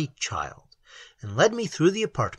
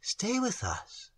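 slow, soft and higher; tender plea; 8 semitones higher, 9 dB quieter, slower than usual. A narrator's reading. Maiden tenderly begging the stranger to stay. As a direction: coax him gently to stay. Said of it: "looked into it tenderly".

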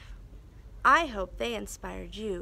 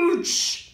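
monster ouch; 6 dB louder than usual. Acted. Monsters pinched.

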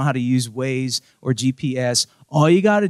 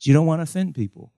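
normal talking voice

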